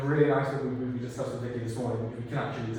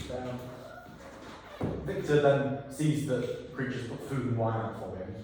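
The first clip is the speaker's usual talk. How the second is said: usual speaking voice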